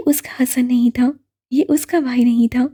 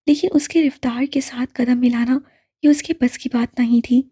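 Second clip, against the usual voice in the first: ordinary delivery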